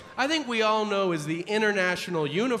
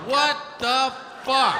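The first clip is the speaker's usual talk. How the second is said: high, loud, drawn-out shock; shocked disbelief; 7 semitones higher, 4 dB louder, slower than usual. Retold or imitated. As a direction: stretch it out in horrified disbelief. Shocked reaction, loud high slow.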